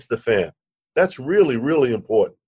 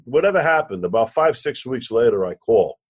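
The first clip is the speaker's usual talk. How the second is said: ordinary talk, nothing marked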